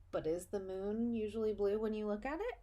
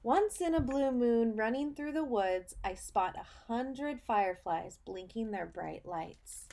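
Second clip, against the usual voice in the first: put-on reading to children; 3 semitones higher than usual. Teacher reading a picture book to her students, storybook voice. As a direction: read it like storytime for little kids.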